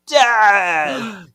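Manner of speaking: loud, drawn-out melodrama; dramatic reveal. A narrator's reading. Host reveals the jurors' deed, loud dramatic flourish. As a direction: land it like a shock twist, slow and booming.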